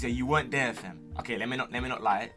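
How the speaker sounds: usual speaking voice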